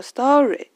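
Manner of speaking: "story" loud and stretched, lifting at the end; drawn-out STORY. Shown as a demonstration. Teacher shows how to slow down, drawn-out word. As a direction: hold the end of "story" long to slow the rhythm. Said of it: "就慢下来了".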